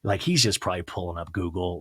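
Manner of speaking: ordinary delivery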